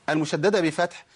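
ordinary talk

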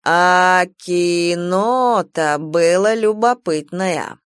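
slow, drawn-out tipsy speech; drunk drawl. Acted. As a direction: stretch the vowels, a little drunk. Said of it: "странно протянул". (пьяная протяжная речь)

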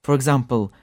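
ordinary delivery

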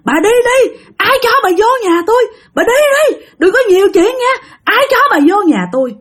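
high-pitched screechy mock yelling; retold high, frantic shouting; imitated screaming. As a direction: act out a man hollering to drive someone off. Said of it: "Ba của bạn thì gào thét phía sau".

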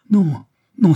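high, loud pleading no; desperate cry. A narrator's reading. Blanche pleading with Olivier in the cell, loud desperate cry.

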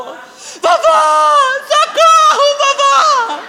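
put-on high shrieking child crying for grandma; child calls her grandmother for rescue, shrieking high; screaming for help. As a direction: shriek it like a scared little girl.

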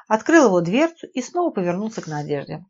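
ordinary delivery

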